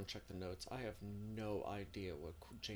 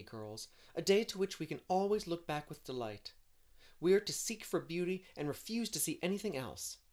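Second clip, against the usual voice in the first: higher, lively storybook heroine; eager, dreamy Anne; 8 semitones higher, 11 dB louder than usual. Acted. Anne planning a birthday outing in the spring, eager and dreamy. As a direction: voice an enthusiastic dreamer, pitched up. Voices Anne rallying her friends.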